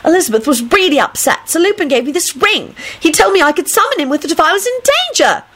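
high-pitched insistent chatter; whiny, excitable Rosie. Acted. Rosie telling how she got the ring, insistent and high. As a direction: argue back in a high girlish voice.

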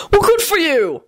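high, delighted cheer. A narrator's reading. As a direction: applaud them with glee. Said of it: "Arthur said delightedly".

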